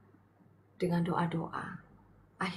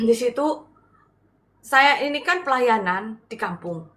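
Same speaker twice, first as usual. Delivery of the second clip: usual speaking voice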